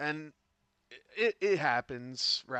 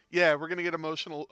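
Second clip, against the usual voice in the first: excited; 5 semitones higher, 9 dB louder, much faster than usual. Real, not acted. Loud fast joke.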